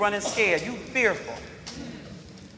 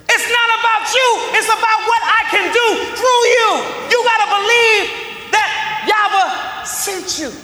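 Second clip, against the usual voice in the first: full-force preaching; 7 semitones higher than usual. Acted. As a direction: hammer the point home. Preacher voicing God's charge.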